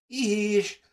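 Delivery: höher und laut; eifrige Meldung. Acted. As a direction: stolz sich melden. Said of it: "meldete sich Eddie". (Eddie meldet sich bei der Fee, hoch und laut)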